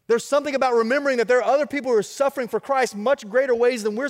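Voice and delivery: rising sermon fervor; full-force preaching. Real, not acted. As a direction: drive it home to the congregation.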